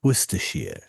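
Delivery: "Worcestershire" said as "Wuss-tuh-sheer"; low-pitched "Worcestershire" with no R after Wuss-tuh; British pronunciation. Shown as a demonstration. Recounting asking UK friends, British pronunciation. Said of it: "how do you pronounce it?".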